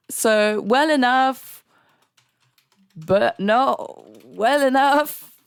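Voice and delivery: high and slow, stretching well enough; sarcastic drawn-out contrast. Real, not acted. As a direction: spell out the absurd catch, slowly. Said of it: "I'm just ranting now".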